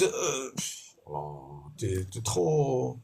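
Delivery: ordinary delivery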